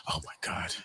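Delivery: very soft breathy oh my God; awed hush. Retold or imitated. Guy feeling her move closer, hushed amazement. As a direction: barely breathe it out. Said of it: "You be like".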